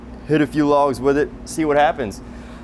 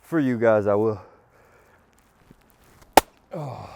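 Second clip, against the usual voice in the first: ordinary talk, nothing marked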